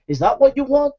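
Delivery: ordinary talk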